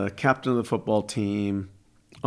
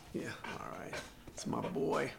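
whispering; 3 semitones higher, 15 dB quieter than usual. Real, not acted. Cooing to her dog.

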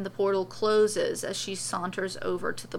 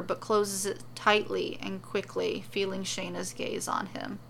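ordinary delivery

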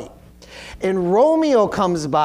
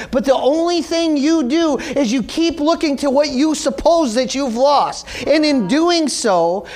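raised-pitch, emphatic sermon delivery; fervent preacher driving the point home; impassioned preaching; 7 semitones higher, 3 dB louder than usual. Real, not acted. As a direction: preach it with urgency, leaning on the key words. Preaches the lesson to congregation.

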